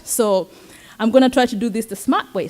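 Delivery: usual speaking voice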